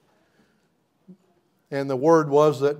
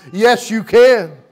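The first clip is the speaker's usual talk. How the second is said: proclaiming; 8 semitones higher, 9 dB louder, slower than usual. Acted. Resurrection voice answering.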